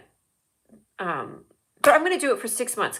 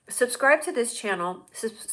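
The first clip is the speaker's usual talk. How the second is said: usual speaking voice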